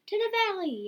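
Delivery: high rallying yell; loud high cry, falling at the end. A narrator's reading. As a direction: call everyone onward, high and loud. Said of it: "yelled Caddy".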